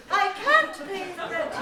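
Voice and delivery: high, loud desperate heroine; damsel in distress. Acted. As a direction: cry out like a helpless maiden.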